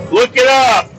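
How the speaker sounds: loud, high-pitched call; shouted command. Real, not acted. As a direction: belt it out suddenly as an order. Calls out a command while driving.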